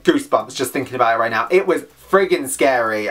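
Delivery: usual speaking voice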